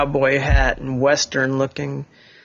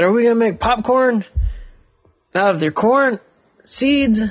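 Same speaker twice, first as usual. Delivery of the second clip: put-on higher, excited little boy asking; eager kid question; young boy asking the cowboy about popcorn; boy's voice; 8 semitones higher than usual. Boy character asks in story.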